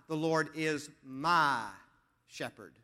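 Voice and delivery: slow, "my" drawn out, then a pause before "shepherd"; emphatic, stretched "my" set apart from the rest; stress on "my". Shown as a demonstration. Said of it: "put the emphasis on the word "my".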